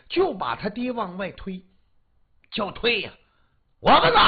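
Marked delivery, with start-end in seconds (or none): 3.8-4.2 put-on high, loud drunken refusal; drunken yell. Drunk father refusing to be pushed out, loud yell. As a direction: bellow it, stubborn and drunk. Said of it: "喝多了".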